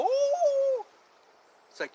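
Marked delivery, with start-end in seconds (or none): 0.0-0.8 high, loud "woo" howl; playful mock dog howl; imitated dog howl. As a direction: howl like the little dog getting into it. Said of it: "He'd go".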